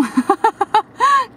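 a hearty laugh; amused laughter; high burst of laughter. Real, not acted. Narrator laughs at his joke.

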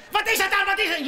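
high, loud baffled yelling; frustrated shout. Retold or imitated. Man shouting at his crashed laptop, baffled and angry. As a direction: demand an answer from the screen. Ranting at screen.

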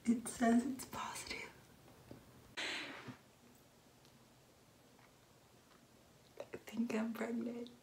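very soft, near-whispered disbelief; stunned hush. Real, not acted. Vlogger reading her positive test, hushed and stunned. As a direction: say it quietly, barely daring to believe it.

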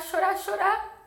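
high, loud, tearful telling; choked with emotion. Real, not acted. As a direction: tell it through rising tears, voice pushed high. Tearful retelling of girl crying.

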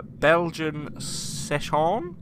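slow, hesitant attempt at a foreign beer name; unsure pronunciation. Real, not acted. Reading the beer can to friends, slow and unsure. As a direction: draw it out, unsure how to say it. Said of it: "I don't know how to say it".

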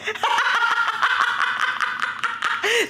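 high, long giggling fit; high helpless laugh. Real, not acted. After her partner asks what she means, high giggling fit. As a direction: crack up helplessly.

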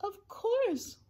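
high, earnest answer; reassuring elephant. A narrator's reading. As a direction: reassure the tiny mayor at once. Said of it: "Horton answered".

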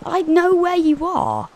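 high, loud imitation of a creature; mimicked last words. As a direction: slowly mimic a menacing creature, high-pitched. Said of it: "what did it say before it died?".

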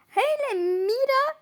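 high, drawn-out witchy voice; a hint of a cackling witch. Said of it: "İkisi de cadı gibi gülüyor". (cadı sesi)

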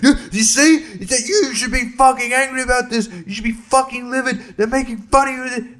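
high-pitched laughing mock fury at a stranger; pointing at a shopper, hammed-up indignant rant. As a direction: lecture him in self-righteous outrage, half laughing. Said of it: "I pointed at him and I was like".

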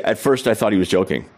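ordinary talk, nothing marked

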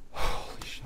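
breathless whispered awe; awed whisper. Real, not acted. Watching the trailer, a stunned whisper. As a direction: whisper it, stunned by what you see.

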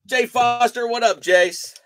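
loud greeting. Real, not acted. Greeting a viewer on stream.

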